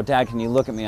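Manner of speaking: an urgent call for his attention; insistent plea. Retold or imitated. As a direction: press him to turn from the TV. Pleading for father's attention.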